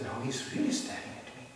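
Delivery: soft, unvoiced worried aside; mock whispered worry. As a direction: hiss it as a nervous thought. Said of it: "now he said".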